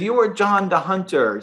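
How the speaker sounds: mock the boss's German accent as he gropes for English. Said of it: "play around with this German accent".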